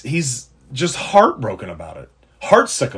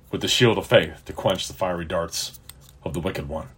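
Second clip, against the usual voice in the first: gentle reassurance; 4 semitones lower, 5 dB quieter than usual. Acted. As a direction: ease a discouraged listener into calm.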